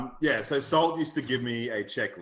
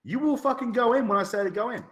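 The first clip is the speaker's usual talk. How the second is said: high, indignant retold order; indignant command; 10 semitones higher, 3 dB louder than usual. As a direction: lay down the law to a defiant player. Retelling angry reply to defiant player.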